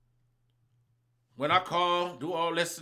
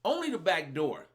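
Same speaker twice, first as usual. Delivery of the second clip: ordinary talk, nothing marked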